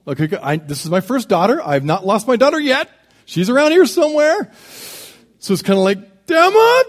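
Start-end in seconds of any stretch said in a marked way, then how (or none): 1.6-4.5 forced composure, pitch climbing; trying to keep cool. Retold or imitated. Dad reassures himself his daughter is around, straining to keep cool. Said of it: "trying to keep cool". 6.3-6.8 high, loud, embarrassed call; voice going up. Retold or imitated. Embarrassed dad calls for missing daughter, voice jumping high and loud. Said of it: "your voice kinda goes up a little bit".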